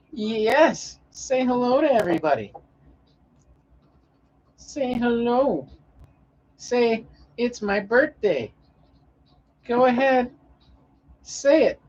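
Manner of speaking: high cooing voice for the cat; pet baby talk. Acted. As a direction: coax the kitty like a baby.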